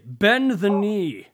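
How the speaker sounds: slow booming royal command; dark lord. Pretend. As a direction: demand they kneel, slowly. Playing king with a crown.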